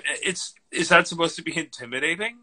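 ordinary talk

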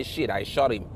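low, soft mock panicked cop; a hushed, muttered imitation of the officer. Mocking the officer after the shooting, low and soft. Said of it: "and then you say".

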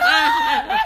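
very high, loud scream through laughter; imitated scared scream. Six-year-old carried out of haunted house, mock scream. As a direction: shriek like a frightened kid, laughing. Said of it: "and I was so scared".

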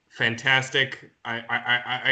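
usual speaking voice

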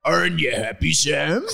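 put-on thick accented character voice; Hagrid, solemn. Hagrid asks Sam again, solemnly, in character. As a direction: ask it earnestly in character. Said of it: "Hagrid again solemnly".

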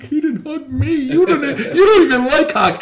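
high, laughing complaint; mock whine. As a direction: sulk about the hug like a kid. Friend complaining, mocked.

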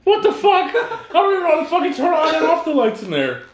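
high, loud laughing outburst; laughing protest. Real, not acted. Friend jokes he becomes the Equalizer, loud laughing protest. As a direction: protest while cracking up.

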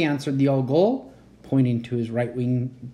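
plain reading voice

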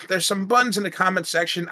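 normal talking voice

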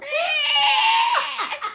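drawn-out high-pitched squeal; long high screech. Acted. Co-host joking about being unstoppable, long mock screech. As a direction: let out a sustained, very high shriek. Mimicking a creature's shriek.

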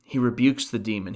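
normal talking voice

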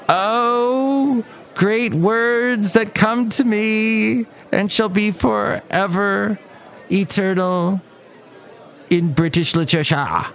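high, slow, grandiose bard declaiming; William Shakespeare. Pretend. Podcaster mocking the playwright addressing his great words, lofty declamation. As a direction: stretch every word in a hammed-up poetic swoon. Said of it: "Was I making fun of William Shakespeare just then?".

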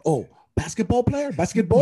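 a hint of a Finnish accent, "Basketball?" asked on a high rise; mock local's guess. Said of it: "they're like, "Oh," they probably assume".

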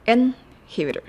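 インヒビター with the tongue tip behind the front teeth on ン; in-hi-bi-tor, English style; English inhibitor. Shown as a demonstration. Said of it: "舌先を前歯の裏につけて発音します".

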